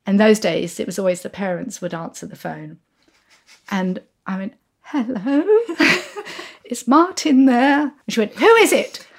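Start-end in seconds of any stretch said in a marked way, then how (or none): 4.8-7.8 high, timid, giggly caller; nervous phone voice. Retold or imitated. Girl phoning her crush's home, mock nervous. 8.3-9.1 high, loud phone challenge; curt parent. Retold or imitated. Parent screening girl's phone call, imitated curt.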